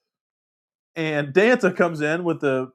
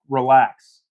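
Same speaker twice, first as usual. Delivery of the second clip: louder, emphatic reassurance; 6 dB louder than usual. Real, not acted. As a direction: call it out to settle the room. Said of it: "This is a family-friendly show, everybody".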